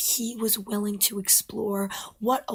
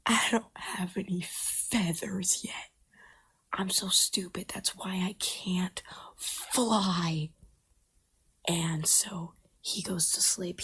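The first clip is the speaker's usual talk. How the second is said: whispered storytelling. Acted. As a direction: whisper the story close to the mic.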